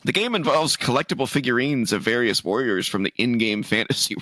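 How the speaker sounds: ordinary delivery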